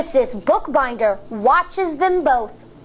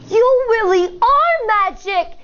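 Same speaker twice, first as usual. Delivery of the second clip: higher, wonder-filled voice; amazement; 6 semitones higher than usual. Acted. Firefly amazed at teacher's rainbow, full of wonder. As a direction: marvel at her. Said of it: "with amazement".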